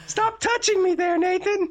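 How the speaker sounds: high, far-off doll voice, giggling; giggly spooky doll; haunted doll voice. Pretend. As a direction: play the creepy doll teasing the host.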